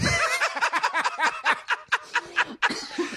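long high-pitched cackle; helpless high laugh; real laughter. Real, not acted. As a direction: crack up at your own story. Laughing at his own impression.

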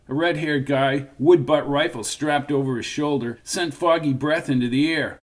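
usual speaking voice

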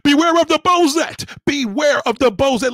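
high, loud hype call of the name; loud warning. Acted. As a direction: bellow it like a street preacher's warning. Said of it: "You are a Bozette".

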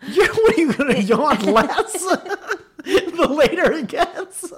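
high, loud laughter through the words; laughing tease. Real, not acted. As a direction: crack up while teasing her. Teasing partner about yawning.

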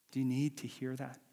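ordinary delivery

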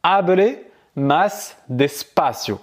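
slowly spelled-out Spanish; slow model phrase. Shown as a demonstration. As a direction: say it slowly for the learner to copy. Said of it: "Donc du coup, tu vas dire".

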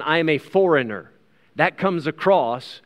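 ordinary delivery

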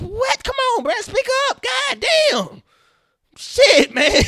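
very high, very loud frustrated yell; exasperated shouting. Acted. Viewer yelling at whispering TV characters, exasperated shout. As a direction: blow up at actors you can't hear.

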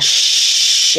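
long unvoiced shh; shh exhale. Shown as a demonstration. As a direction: breathe out through teeth. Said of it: "Hear the sound".

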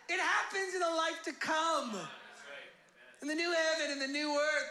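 preaching at full force. Real, not acted. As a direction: drive it home to the congregation.

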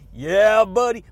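loud, hyped cheer; loud catchphrase. Real, not acted. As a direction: shout it like a hype man. Shouted catchphrase.

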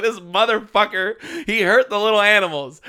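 high, loud indignant rant; emotional outburst. Retold or imitated. Podcaster reenacts fury at the villain, loud emotional outburst. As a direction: rage on behalf of the animals, hammed up. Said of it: "I was so emotional".

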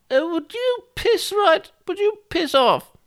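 very high, slow posh falsetto; piping, genteel posh dismissal; posh old woman. Shown as a demonstration. Old woman sending a caller away, very posh. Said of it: "No, it sounds very posh".

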